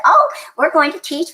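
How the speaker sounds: nonchalant. Retold or imitated. Telling a traveler in the customs line their plans, nonchalant. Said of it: "we were just so nonchalant".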